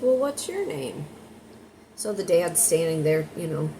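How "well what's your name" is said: put-on little girl. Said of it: "she's giggling. She's like".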